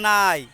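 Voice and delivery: slow, clear নাই in Sirajganj dialect; Sirajganj dialect word. Shown as a demonstration. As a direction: say নাই slowly with n in place of l, Sirajganj style. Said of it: "আমরা সিরাজগঞ্জের আঞ্চলিক ভাষায়".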